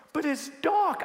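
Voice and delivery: put-on high little-boy protest; scared little boy. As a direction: whine dark like a toddler. Son's voice, high.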